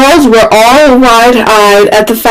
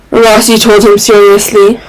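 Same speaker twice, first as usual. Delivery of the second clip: ordinary delivery, nothing marked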